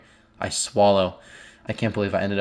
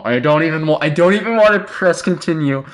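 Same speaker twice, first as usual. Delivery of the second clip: higher, louder, panicky pleading, nervous laugh, ending in a scream; panicked dread into scream; 10 semitones higher, 8 dB louder than usual. Real, not acted. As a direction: beg not to go on, then shriek. Dreading next click, then shock.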